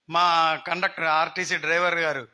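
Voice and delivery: ordinary talk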